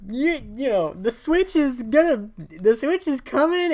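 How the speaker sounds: high, halting announcer pitch; mock hype announcement. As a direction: stumble excitedly through an announcement to a crowd. Rehearsing announcement to launch crowd.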